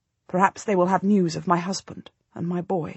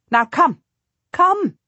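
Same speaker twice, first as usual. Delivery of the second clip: high, louder, pressing come, come; urging; 9 semitones higher, 4 dB louder, slower than usual. A narrator's reading. Queen urging Jacquetta to ride on, eager urging. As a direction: hurry them along eagerly. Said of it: "she urges".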